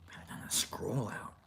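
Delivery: breathy whispered line. Acted. Vlogger whispers the idea before saying it. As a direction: whisper it to yourself.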